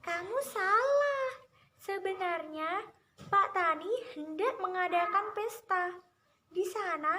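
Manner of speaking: high-pitched coaxing little trickster voice; high storybook mouse-deer, sweetly persuading; smiling mouse-deer. A narrator's reading. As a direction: tempt the listener in a high, smiling voice. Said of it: "Kancil pun tersenyum dan berkata".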